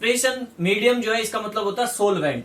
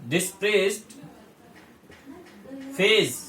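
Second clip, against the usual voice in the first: डिस्प्रेस्ड and phase split by a long pause; डिस्प्रेस्ड, pause, then phase, spaced out for notes; 2 semitones higher than usual. Shown as a demonstration. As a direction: dictate it word by word so students can write. Teacher dictates term for notes.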